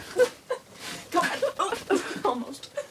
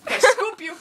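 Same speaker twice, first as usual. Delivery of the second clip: coaxing a wild bird; 13 semitones higher, 5 dB louder than usual. Real, not acted.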